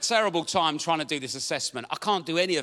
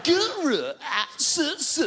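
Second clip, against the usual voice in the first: glitching Zoom voice; 10 semitones higher than usual. Retold or imitated. Assessor on a buffering Zoom call, letters stretched. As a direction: spell it like a frozen video call.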